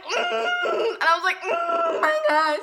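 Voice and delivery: very high, loud squeal; nervous squeal. Retold or imitated. Girl squealing to herself beside her crush. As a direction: squeak out a mortified hum.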